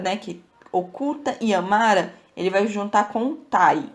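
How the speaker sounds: ordinary talk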